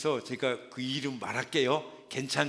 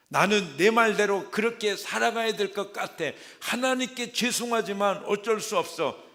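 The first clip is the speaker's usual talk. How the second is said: much higher, louder mock self-justifying; hammed-up resolve; 8 semitones higher, 10 dB louder than usual. Believer choosing his own way over God, loud mock resolve. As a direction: declare it loudly with a mock shrug.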